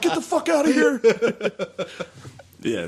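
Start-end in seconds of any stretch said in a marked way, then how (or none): 0.0-1.0 very high, louder laughing mock voice; mock yell. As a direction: yell it as a cartoon bigot. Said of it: "I'm like".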